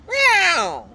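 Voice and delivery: very high, loud angry cat yowl; a long loud falling cat screech; nasty yowl. Acted. Cat sound list, repeated.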